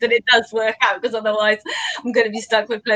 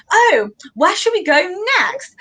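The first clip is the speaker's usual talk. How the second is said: higher-pitched mock posh English; imitated posh accent; 8 semitones higher than usual. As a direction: mimic a posh man on a night out. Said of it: "Really posh, this guy was talking".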